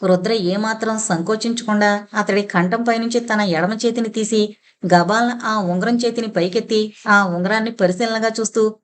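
ordinary delivery

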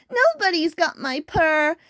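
high, plaintive kitten complaint; put-on high wailing; kitten's wail. A narrator's reading. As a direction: wail it all out like a tiny kitten. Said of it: "wailed little kitten".

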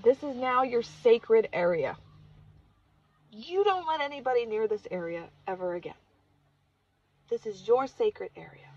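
loud, deliberate pronouncement; emphatic shaman giving an order; solemn command. Retold or imitated. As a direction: lay down the law, leaning on key words. Shaman laying down a rule.

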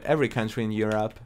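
ordinary talk

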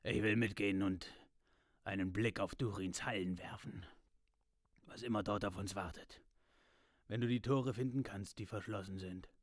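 a touch of the dwarf voice, determined; dwarf eager to see Durin's halls; hint of a dwarf, resolute; lightly voiced dwarf Gimli. A narrator's reading. Gimli voiced in read story.

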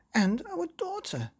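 high, indignant mother's shout. Acted. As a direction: remind him about the daughter. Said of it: "shouted Mother".

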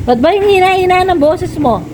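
high-pitched, exasperated question; exasperated scolding. Real, not acted. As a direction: scold her for mumbling. Parent scolding child about pooping.

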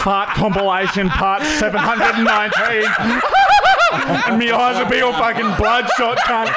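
high, squeaky laughing speech; laughing hard. Real, not acted. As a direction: talk through a fit of giggles. Cracking up at own joke.

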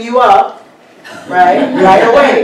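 gleeful laughing claim. Real, not acted. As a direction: burst out laughing as you claim her.

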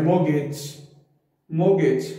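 मोगेज as 'mo-gayj', no r or t; lengthened second syllable. Shown as a demonstration. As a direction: draw out the 'gej' of मोगेज. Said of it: "इसको हम लंबा कहें". (मोगेज में 'गेज' लंबा)

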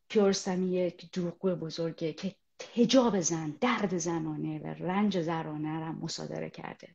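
ordinary talk, nothing marked

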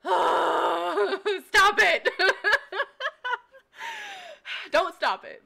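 loud, tearful laughing cry; half laugh, half sob, high and loud. Real, not acted. Crying and laughing over a viewer's kind comment.

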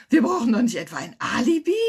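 loud, high, excited question; thrilled squealing. Acted. Lounger thrilled at needing an alibi, squealing. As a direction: blurt it out far too loudly.

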